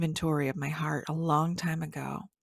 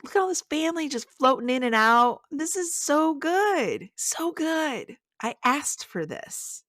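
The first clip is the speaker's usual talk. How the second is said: high, drawn-out delight; overjoyed wonder; 10 semitones higher than usual. Retold or imitated. Aunt marveling at family around her, high overjoyed wonder. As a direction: marvel in a raised voice, slowing to savor it.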